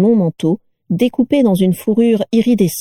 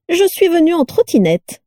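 high-pitched, delighted proclamation; radiant announcement; 10 semitones higher than usual. A narrator's reading. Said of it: "déclara-t-elle rayonnante". (annonce radieuse, voix haut perchée)

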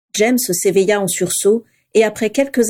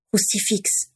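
soft, murmured password; Rémus murmurant le mot de passe; 7 dB quieter, slower than usual. A narrator's reading. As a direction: murmur the word to the gargoyle. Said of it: "murmura Rémus".

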